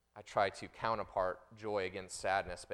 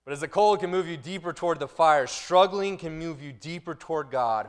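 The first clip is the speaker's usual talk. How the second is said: louder, higher, driving the line home; emphatic louder preaching; building preach; 9 semitones higher, 13 dB louder than usual. Shown as a demonstration. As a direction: preach the line with full force. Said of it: "Think about that".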